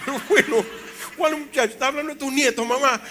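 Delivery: high, loud, laughing disbelief; amused protest. Retold or imitated. Protesting to his mother, laughing.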